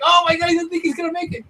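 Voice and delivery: high, fast excited retelling; breathless hope. Retold or imitated. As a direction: blurt it out in rising hope. Retold hope as ray swims.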